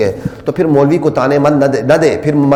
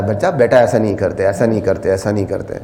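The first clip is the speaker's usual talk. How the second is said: softer, lower coaxing father; loving father; 7 semitones lower, 7 dB quieter than usual. Retold or imitated. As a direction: gently coax a child. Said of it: "بڑے پیار سے کہتا ہے". (باپ کا بچے کو نرمی سے سمجھانا)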